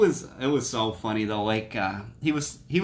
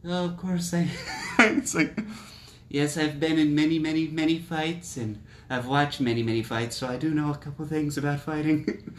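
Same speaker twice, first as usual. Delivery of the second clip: higher, boastful mock tycoon voice, laughing in; Donald Trump impression; 4 semitones higher than usual. Podcaster imitating Trump boasting to a fighter, lightly voiced. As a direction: puff it up like a bragging ex-president. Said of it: "And he's like".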